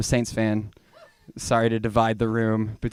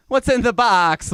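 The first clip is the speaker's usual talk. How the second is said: higher, slow, laughing "what's in the box"; mock Brad Pitt yell; 10 semitones higher, 4 dB louder, slower than usual. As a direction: shout it like the movie scene. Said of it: "She's like".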